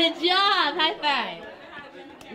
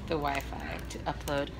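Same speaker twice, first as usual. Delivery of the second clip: whispering; 7 semitones lower than usual. Real, not acted. Whispering in restaurant.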